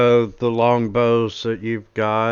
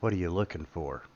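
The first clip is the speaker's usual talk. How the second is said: quick, soft aside, voiced rather than whispered; confidential murmur; 4 semitones lower, 8 dB quieter, faster than usual. Merchant leaning down to customer, quick hushed murmur. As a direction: drop the volume and ask on the sly. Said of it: "he starts whispering to you".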